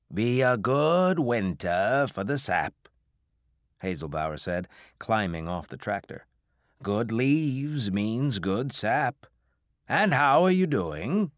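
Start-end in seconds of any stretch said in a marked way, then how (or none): 0.1-2.7 New England drawl. A narrator's reading. Old farmer voiced in story. 6.8-11.3 slow New England farmer drawl; big old farmer, slow and warm. A narrator's reading. Old farmer voiced in story.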